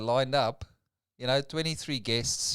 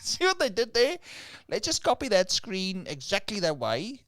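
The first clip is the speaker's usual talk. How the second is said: terrible mocking voice; 8 semitones higher than usual. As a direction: ham up a silly voice for laughs. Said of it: "Your voice is terrible".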